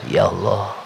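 a father's broken prayer, held low; tearful prayer. Retold or imitated. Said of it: "beliau menangis kepada Allah".

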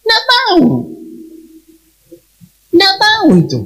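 high, bewildered "don't know"; mock bewilderment; baffled. Baffled parents answer.

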